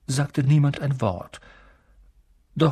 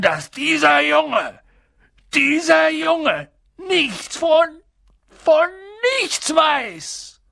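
high, slow, straining shout; furious; 3 dB louder, slower than usual. A narrator's reading. Giant confronts the relatives about the boy's ignorance, furious. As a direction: build to an outraged yell, halting between phrases. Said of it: "In seinem Zorn".

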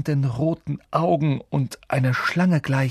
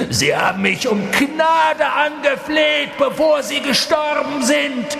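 gloating shriek; 12 semitones higher, 4 dB louder than usual. A narrator's reading. Voldemort boasts to Harry how his parents begged, shrieking. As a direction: shriek it out in cruel triumph. Villain gloats about murder.

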